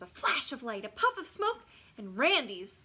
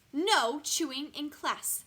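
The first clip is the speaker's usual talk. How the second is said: higher, louder, slow smiling scold; 6 semitones higher, 6 dB louder than usual. A narrator's reading. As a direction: scold slowly through a smile. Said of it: "she smiles".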